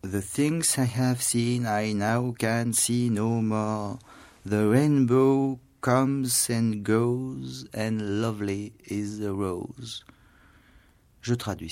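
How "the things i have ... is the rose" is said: slow, lifted, solemn reading of Wordsworth; poem recitation. Shown as a demonstration. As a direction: recite it as a cherished verse. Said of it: "je le recite".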